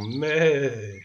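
long drawn-out, mournful "mêê"; sad goat bleat. A narrator's reading. (bêlement triste de chèvre)

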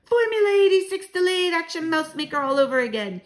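high, loud proclamation; triumphant shout. A narrator's reading. Grandmother announcing the witch-destroying formula, shouted. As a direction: declaim the formula loudly, pitched well up. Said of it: "my grandmother shouted".

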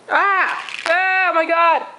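sudden cry of real surprise; startled cry. Real, not acted. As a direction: gasp out in shock and pain. Glitter just flew into her eye.

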